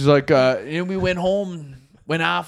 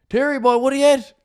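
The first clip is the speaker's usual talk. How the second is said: higher, louder acted call-out; hearty greeting; 10 semitones higher, 5 dB louder than usual. Storyteller acting a man greeting the bar owner, hearty call. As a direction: hail an old pal across a bar.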